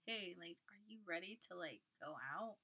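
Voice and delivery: sneaky, hushed-down question; imitating sneaky. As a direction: drop it right down, like a secret invite. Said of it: "like, sneaky about it".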